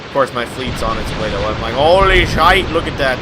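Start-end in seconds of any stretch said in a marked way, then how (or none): 1.7-3.2 loud, high burst of disbelief; shocked gamer outburst; mock shock. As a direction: exclaim it big, pitched up. Mock shock at torpedo hit.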